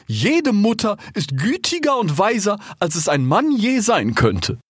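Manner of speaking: high, emphatic oratory; giraffe, insistent. A narrator's reading. Giraffe champions a queen to the animals, high and insistent. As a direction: argue the case in a high voice.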